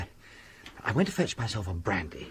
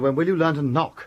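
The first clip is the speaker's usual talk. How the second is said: irritated scolding; 6 semitones higher, 6 dB louder than usual. Acted. Scolding the housekeeper.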